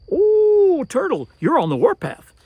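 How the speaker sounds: high, loud surprised greeting; excited greeting. Acted. Skunk spots Turtle, loud excited greeting. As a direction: call out eagerly to Turtle.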